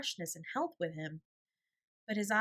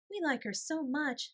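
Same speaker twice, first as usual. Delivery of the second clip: put-on higher, bright little girl voice; a young sister chiming in; child voice; 8 semitones higher than usual. A narrator's reading. Little girl telling the gentleman how much they like her. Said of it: "said Nora".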